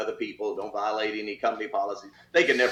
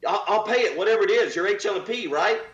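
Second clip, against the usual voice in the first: eager suspect; 8 semitones higher, 4 dB louder than usual. Retold or imitated. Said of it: "And he said".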